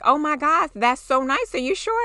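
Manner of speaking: surprised by the kindness; touched surprise. Retold or imitated. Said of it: "they're like".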